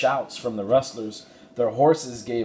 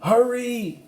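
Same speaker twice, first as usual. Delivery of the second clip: loud, much higher urgent cry; 5 dB louder than usual. A narrator's reading. Jack urging Dusty as rustlers close in, crying out. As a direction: shout it in panic. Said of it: "Jack cried".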